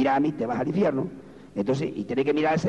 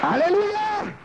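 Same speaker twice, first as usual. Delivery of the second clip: high, ringing praise shout; rallying cry; much slower than usual. Real, not acted. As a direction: call out to the whole congregation. Rallying congregation after applause. (grito de júbilo aclamando)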